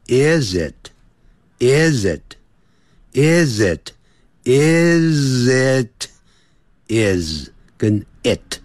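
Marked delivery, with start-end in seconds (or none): 0.1-7.5 "is it" linked into one smooth "izit", repeated slowly; linking demo. Shown as a demonstration. Teacher drilling linked sounds for students, slow repeated linking. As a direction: drill "is it" slowly, sliding the z straight into the i. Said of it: "产生连音".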